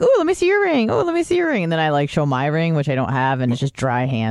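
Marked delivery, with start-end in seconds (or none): 0.0-1.6 squealing excitedly over rings; high-pitched gushing girl voice; high girly squeal. Retold or imitated. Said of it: "and I'm like".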